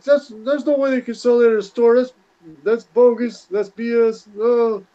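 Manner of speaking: high, loud frantic outrage; hammed-up burning-mouth rant; freaking out. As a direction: rant like your mouth is on fire. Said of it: "he's just freaking out".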